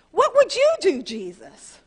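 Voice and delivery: high, loud desperate blurt then dropping on Jesus; high anguished shout to God; blurted cry. Retold or imitated. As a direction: cry out the question before you can stop it. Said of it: "I didn't even mean to say that".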